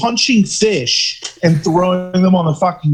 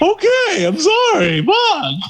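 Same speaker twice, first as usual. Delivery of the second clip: mock whiny kid; slower than usual. Son mocks himself answering dad.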